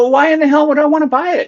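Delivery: high, fast mock-exasperated question; exasperated disbelief. To his brother pushing the old boat, exasperated. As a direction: throw it back at him, baffled.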